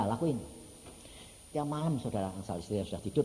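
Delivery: normal talking voice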